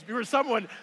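normal talking voice

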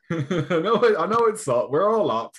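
high, loud manager voice breaking into laughs; mock manager laughing. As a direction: play the pompous manager while cracking up.

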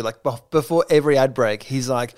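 normal talking voice

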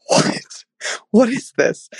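mock-appalled aside to a colleague; retold disbelief.

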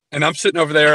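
ordinary talk, nothing marked

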